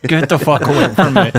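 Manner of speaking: laughing through a mock snarl; half-laughing imitation of angry mail carrier; laughing mock rage. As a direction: growl it while cracking up. Retold inner rage.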